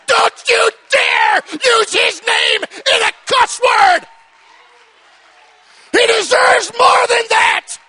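slow, loud and high pulpit warning; outraged preacher forbidding cussing; stern rebuke. Acted. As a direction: slam the warning down word by word. Preacher scolding those who cuss.

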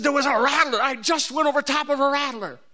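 high and excited, rushing the news; excited kid. Retold or imitated. Brother tells his sibling about the snake, high and excited. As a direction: blurt the news like a startled boy.

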